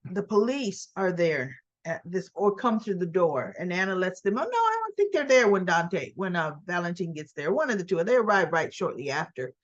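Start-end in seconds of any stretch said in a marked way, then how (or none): 4.4-5.5 high, quick brush-off. Retold or imitated. Anna brushing off the police.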